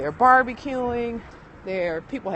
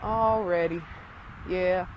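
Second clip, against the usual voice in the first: ordinary delivery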